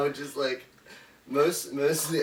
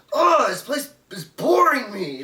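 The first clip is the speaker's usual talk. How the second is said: loud, high whining outburst; mock tantrum, pitched up and loud; yelling; 10 semitones higher, 9 dB louder than usual. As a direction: imitate a bored man yelling his complaint. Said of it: "starts, like, yelling".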